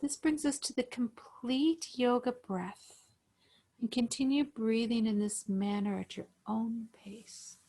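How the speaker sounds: calm, even breathing-guide voice; guided meditation. Acted. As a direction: pace each cue with the breath, unhurried.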